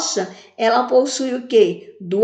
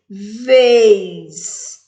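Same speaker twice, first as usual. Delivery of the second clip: "Vez" as a single long beat; one drawn-out syllable; 5 dB louder than usual. Shown as a demonstration. Teacher showing a one-syllable word, "Vez" held long. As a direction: stretch "Vez" out in one piece. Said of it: "Eu falei o quê? Uma vez".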